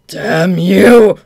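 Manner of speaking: very loud enraged cry; furious shout. Acted. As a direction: explode with rage. Enraged villain outburst.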